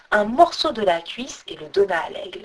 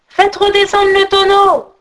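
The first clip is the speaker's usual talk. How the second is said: shouted order; 10 semitones higher, 7 dB louder than usual. A narrator's reading. Jean de l'Ours shouts up to his companions, high loud call. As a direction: call it out on a high held pitch. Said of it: "Ils entendirent Jean de l'Ours crier".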